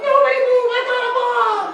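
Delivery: cartoon villain shrieking a threat; goblin bomb threat. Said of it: "holds it up and goes".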